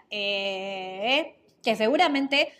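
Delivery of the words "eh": long, drawn-out hesitation "eh"; filler "eh". Shown as a demonstration. Podcast teacher demonstrating a verbal filler, hesitant drawn-out "eh". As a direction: stretch out a filler sound as if searching for words. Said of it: "por ejemplo, la muletilla".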